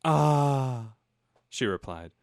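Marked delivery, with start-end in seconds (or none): 0.0-0.9 a hammed-up longing ah; mock sigh. The pining wife answers her husband, mock sigh.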